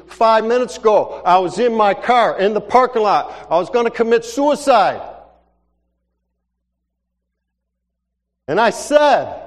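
high, loud distraught confession; acted anguished outburst. Crying woman explains herself to stranger, acted distraught outburst. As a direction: push it out high and loud, imitating a bawling woman. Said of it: "She's crying, bawling".

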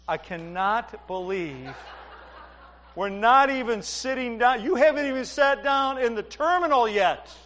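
high-pitched imitation of an incredulous wife; mock exasperation. Wife to husband who brought a stranger back, mock exasperated. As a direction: start slow, then climb into high-pitched disbelief. Wife's exasperated look voiced in story.

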